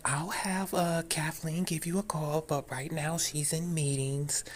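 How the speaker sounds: high, soft imitation of a receptionist; talking-to-a-puppy tone. Receptionist putting off a caller, talking-to-a-puppy tone. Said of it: "giving me that kinda tone".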